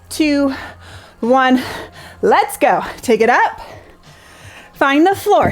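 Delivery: energetic live exercise cues; aerobics instructor. Acted. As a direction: drive them through the set. Cues exercise during interval.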